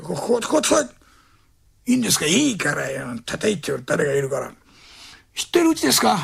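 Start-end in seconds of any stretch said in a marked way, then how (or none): 2.3-4.5 put-on a low drunken mumble. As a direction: drop the voice low and mutter. Drunk mutters to knock. (低くつぶやく酔っ払い)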